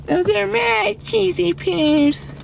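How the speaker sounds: high, slow, drawn-out whiny cartoon voice; Eric Cartman. Streamer showing viewers how Cartman talks, cartoon impression. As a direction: stretch it out like a high-pitched cartoon kid. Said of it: "That's how he talks".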